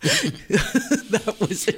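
laughing through the words, very slow; chuckling. Real, not acted. Reacting to partner's Cornish voice, chuckling. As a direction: let a chuckle carry the line.

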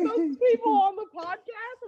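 high, incredulous question; high, teary-laughing disbelief. Retold or imitated. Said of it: "And he's like".

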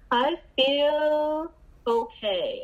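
high, slow, drawn-out mock answer; imitating her old evasive self. Answering her sponsor's how-do-you-feel in a high, slow put-on voice. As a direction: stretch it out high and slow, dodging the question. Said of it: "And I was like".

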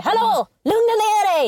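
high, drawn-out 'calm down!'; mock exasperated call. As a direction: call it out slowly and high, acting the exasperated boyfriend. Acting the abuser telling her off.

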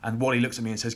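ordinary talk, nothing marked

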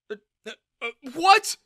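stammering into a high, loud yelp; splutter, then burst out; flustered shock. Acted. Father taken aback by his daughter, flustered.

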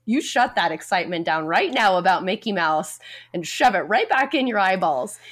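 mock scolding. Hypothetical adult scolding an excited child, mock scolding. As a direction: play at sternly shutting down a child.

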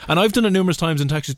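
ordinary talk

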